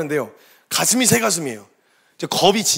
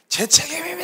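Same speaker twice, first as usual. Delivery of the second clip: 오열 흉내; 9 semitones higher than usual. Retold or imitated. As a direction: sob it out like the distraught pastor. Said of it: "얼마나 속이 상하셨는지 목사님이". (목 놓아 오열하듯)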